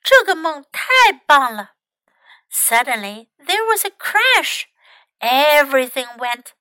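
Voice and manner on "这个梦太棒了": delighted, higher and louder; happy. A narrator's reading. Mouse delighting in his dream, happily louder. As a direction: say it happily, with lifted pitch. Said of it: "他开心地说道".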